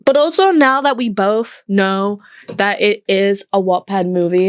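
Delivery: usual speaking voice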